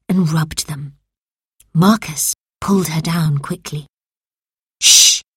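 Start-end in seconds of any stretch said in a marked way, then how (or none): 4.8-5.2 loud, sharp shushing; urgent shush. A narrator's reading. Marcus silencing Camilla as someone comes, urgent shush. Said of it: "he whispered".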